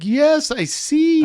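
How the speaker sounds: string it out to please him; mock agreement. Traveler humoring the officer, high slow placating reply.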